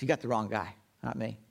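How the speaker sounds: low, hushed brush-off; a nervous stranger quietly fobbing off an accuser; low hushed denial. Acted. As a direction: deny it under your breath, low and soft. Peter denies knowing Jesus.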